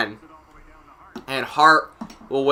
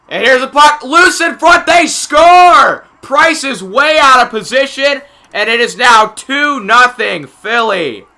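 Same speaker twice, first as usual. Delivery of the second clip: high, loud shouted play-by-play; excited goal call; 9 semitones higher, 9 dB louder, slower than usual. Real, not acted. Announcer calling a Philly goal, excited shouting. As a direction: explode on the goal, then ease slightly.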